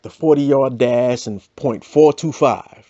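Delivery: normal talking voice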